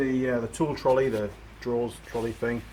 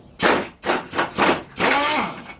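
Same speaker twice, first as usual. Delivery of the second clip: laughing burst; 13 semitones higher, 8 dB louder than usual. Real, not acted. Hoist lowering, laughing.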